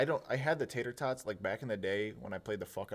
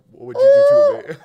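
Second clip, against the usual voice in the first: high shrieked question; shrieking; 22 dB louder, much faster than usual. Pretend. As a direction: screech it in mock outrage. Mock-outraged repeat of the title.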